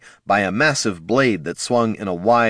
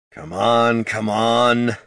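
impatient mutter; 3 semitones higher, much slower than usual. A narrator's reading. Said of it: "Barty muttered impatiently".

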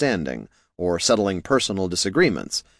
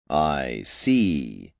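put-on low Ruskin-accented voice, vowels drawn out; 6 semitones lower than usual. A narrator's reading. Wizard answering a newcomer, low Ruskin accent. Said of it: "His vowels spun out slightly longer, traces of a Ruskin accent".

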